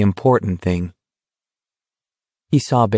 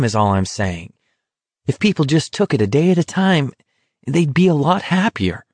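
higher-pitched exasperated argument; acted exasperation, pitch swinging up; 6 semitones higher than usual. A narrator's reading. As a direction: argue it out, thoroughly exasperated. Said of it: "more exasperated than ever".

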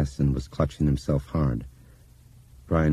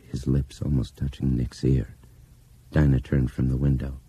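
plain reading voice

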